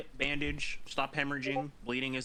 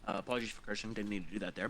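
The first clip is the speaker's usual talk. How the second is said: usual speaking voice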